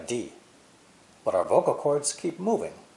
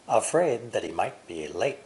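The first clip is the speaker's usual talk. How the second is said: normal talking voice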